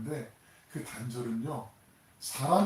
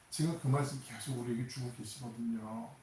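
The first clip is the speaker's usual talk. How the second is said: ordinary talk, nothing marked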